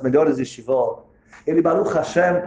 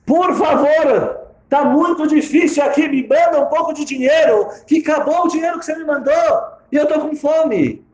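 high, loud, desperate begging for money; pleading letter; 12 semitones higher, 4 dB louder than usual. Acted. Son writing to his father, begging for money in a high, desperate voice. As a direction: whine it out like a hungry, broke student.

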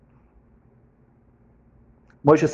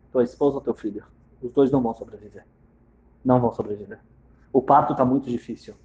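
grave doctor; 6 semitones lower, 4 dB quieter, faster than usual. Acted. Grave doctor delivers bad news.

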